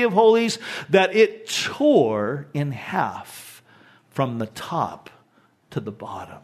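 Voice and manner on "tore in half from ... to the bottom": slow dramatic fade. A narrator's reading. Veil tearing, slowed and fading.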